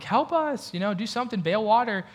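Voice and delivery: high-pitched mock cry for help; drowning men's plea. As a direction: act out panicked men calling out, pitch jumping up. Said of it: "they're drowning, they're like".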